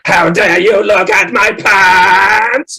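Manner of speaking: high, loud, very slow indignant Dalek voice; embarrassed Dalek. Pretend. Dalek caught in frilly pants protests, very slow and indignant. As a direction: play an affronted robot caught in pants. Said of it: "It was like".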